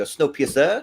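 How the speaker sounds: mock New Zealand accent, inflection rising at the end; exaggerated New Zealand questioning lilt. Shown as a demonstration. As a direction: show off a Kiwi upturn on the last syllable. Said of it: "You gotta have the inflection at the end".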